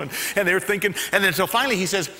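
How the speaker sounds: ordinary talk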